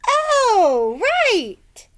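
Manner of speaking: high surprised oh, then recovering; a flustered old woman; caught out. Acted.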